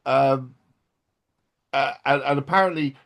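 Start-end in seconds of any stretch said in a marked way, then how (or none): none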